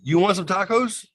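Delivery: high, loud, cheeky tacos offer; high goofy offer. Retold or imitated. Offering the cop tacos, high and cheeky.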